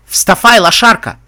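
loud, high-pitched bark; barked order. Acted. Guard rousing the prisoner in her cell, loud bark. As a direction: roar it as a bullying jailer. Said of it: "рявкнул охранник на ломаном эквестрийском".

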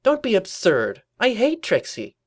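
indignant, drawn-out denial; high-pitched grumble. A narrator's reading. Twilight denying any fondness, high indignant grumble. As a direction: protest it high and slow. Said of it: "Twilight grumbled".

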